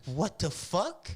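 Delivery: slow, high drawn-out disbelief; mom's shocked what the fuck. Retold or imitated. Mom stunned at the lawyer's office, slow disbelief. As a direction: stretch it out, stunned and rising. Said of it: "And she's like".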